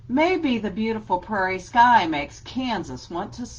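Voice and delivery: usual speaking voice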